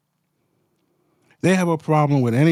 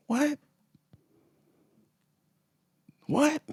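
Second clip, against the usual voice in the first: high, rising incredulous question; disbelief; 14 semitones higher, 6 dB quieter than usual. Real, not acted. Reacting to government spending news, high incredulous. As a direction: ask it as if you can't believe it.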